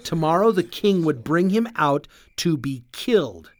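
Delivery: usual speaking voice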